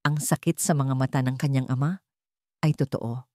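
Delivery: ordinary delivery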